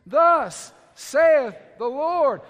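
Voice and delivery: drawn out, loud, each word weighed; slow loud proclamation. Shown as a demonstration. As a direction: call it out slowly with weight. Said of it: "Say it with me".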